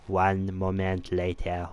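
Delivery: put-on slow, drawn-out French-accented announcer; bad French announcer. Gamer imitates SpongeBob's French announcer, badly done French accent. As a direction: do a poor French narrator impression. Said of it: "that was a pretty bad impression".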